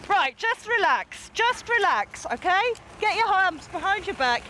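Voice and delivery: high, strained calming orders; urgent, tense commands. Real, not acted. Officer calming struggling suspect.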